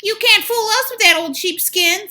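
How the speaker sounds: high loud little pig; high defiant pig voice. Acted. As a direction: scoff at the wolf, high. Pig defies wolf.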